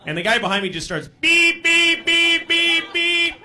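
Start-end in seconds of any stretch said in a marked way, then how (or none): 1.2-3.3 loud, high car-horn beeps; imitated car honking. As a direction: honk insistently with your voice. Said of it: "he's honking just at me".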